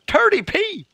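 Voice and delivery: high, drawn-out incredulous question; hammed-up disbelief with a laugh; mock disbelief. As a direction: squeal it in comic astonishment. Repeats mock southern exchange.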